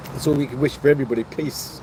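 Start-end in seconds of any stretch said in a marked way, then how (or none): 1.2-1.8 a soft breathy whisper of Peace; hushed whispered Peace. Real, not acted. Echoed sign-off, whispered.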